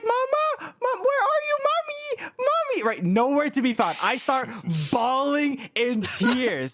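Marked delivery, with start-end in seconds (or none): 0.0-2.8 put-on very high, lost little kid calling out; high childish wail for mom; baby voice calling mom. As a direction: call for mommy like a lost toddler. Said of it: "In that voice?".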